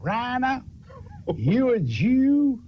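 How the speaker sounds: a bigot calling across the barracks; menacing barracks bully. Retold or imitated. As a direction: stretch the name slow like a taunt across the room. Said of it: "I never heard my name said like that".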